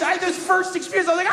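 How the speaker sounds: usual speaking voice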